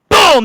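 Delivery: loud high "boom!" impact shout; big shouted impact sound. Acted. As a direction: slam the boom out like a blow. DM narrating a smite hit.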